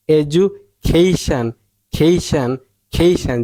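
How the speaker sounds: খেইশান with a long, strong first syllable; stressed "kei". Shown as a demonstration. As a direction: punch "kei" before a short "shan" in খেইশান. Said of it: "সঠিক উচ্চারণ হচ্ছে". (খেইশান, "খেই"-তে জোর)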